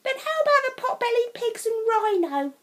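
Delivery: high, eager cub voices; little cubs hoping for a yes; bright childlike question; cubs asking. A narrator's reading. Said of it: "asked the cubs".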